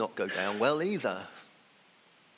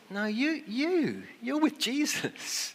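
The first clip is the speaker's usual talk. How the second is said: high, pointed accusing voice; a servant girl singling Peter out, high and accusing; 13 semitones higher, slower than usual. Acted. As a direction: jab the finger with each you, much higher.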